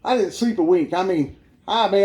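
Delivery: ordinary talk, nothing marked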